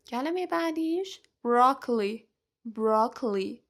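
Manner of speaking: ordinary talk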